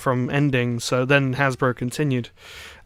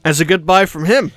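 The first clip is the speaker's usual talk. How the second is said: echoed sign-off; 2 semitones higher, 5 dB louder than usual. Acted. Echoing the sign-off.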